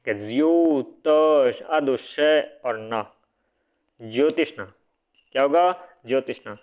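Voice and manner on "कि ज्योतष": stretched syllables. Shown as a demonstration. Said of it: "ज्योतिषना नहीं होता".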